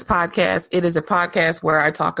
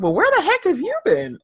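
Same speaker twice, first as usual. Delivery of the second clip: incredulous; 12 semitones higher than usual. Retold or imitated. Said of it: "You be like".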